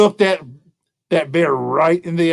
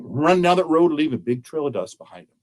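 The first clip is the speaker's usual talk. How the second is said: plain reading voice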